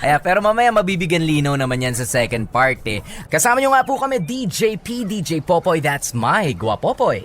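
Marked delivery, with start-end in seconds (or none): 3.3-7.2 upbeat DJ announcer read; radio host sign-off. Acted. DJ introducing himself to listeners, upbeat announcer. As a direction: sell the show like a radio jock.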